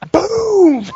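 high, hammed-up kid shrieking; overexcited kid. Nineties ad kid winning at Battleship, high overexcited squeal. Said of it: "way too excited to play Battleship".

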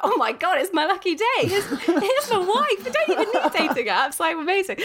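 hammed-up excited squeal of talk; delighted goblin. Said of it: "the water goblin is like".

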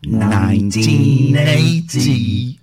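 very slow, loud robotic drone. Shown as a demonstration. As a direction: drone it out like a machine. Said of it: "go nineteen eighty in a robot voice".

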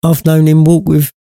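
high, breaking mother's voice; voice cracking with sadness. A narrator's reading. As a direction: let the voice crack on walk. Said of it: "her voice cracked in sadness".

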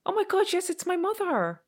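high, excited recognition; sudden realisation. Real, not acted. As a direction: it suddenly clicks. Realising who the voice is.

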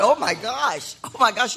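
high-pitched, taken-aback exclamation; mock shock. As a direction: reel back in retold astonishment. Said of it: "I was thrown backwards".

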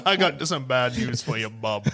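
low tough-guy voice; tough-guy hero. Acted. Player echoes the hero's bad news, low and gruffly tough.

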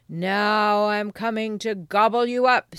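loud, threatening troll voice; roaring troll. Acted. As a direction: threaten to devour them as a troll. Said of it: "roared the troll".

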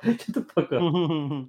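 slow words through real laughter; laughing. Real, not acted. Laughing at own joke about the bottom-pinching daughter, laughing. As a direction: laugh out the line as you say it.

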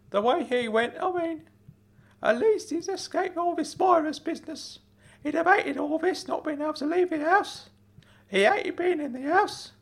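very high uncle voice, reflecting on a loss; Uncle Claude, reminiscing sadly. Acted. Uncle remembers nephew's late dad, high and wistful. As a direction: ponder aloud in a high character voice.

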